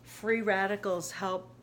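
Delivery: ordinary talk, nothing marked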